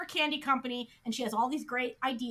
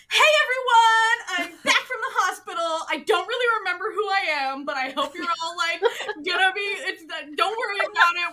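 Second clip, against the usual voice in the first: high, loud, bubbly greeting; pile of sunshine; 3 semitones higher, 6 dB louder than usual. Retold or imitated. Heroine back from hospital greeting coworkers, loud and sunny. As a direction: burst in beaming, pitched up and gushing. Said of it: "this pile of sunshine".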